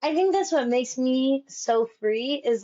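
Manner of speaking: usual speaking voice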